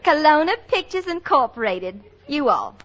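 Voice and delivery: put-on Southern accent. Secretary reading back dictation to her boss, thick Southern accent. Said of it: "with a real Southern accent".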